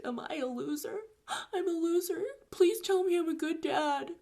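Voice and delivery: high, whimpering mock sob; imitating crying. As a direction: plead through tears like a broken man. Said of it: "And he's crying".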